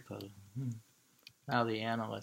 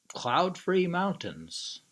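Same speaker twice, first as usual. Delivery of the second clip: slow, higher, louder title; poem title read; 8 semitones higher, 6 dB louder, slower than usual. Acted. Lecturer announcing the poem title with slow weight.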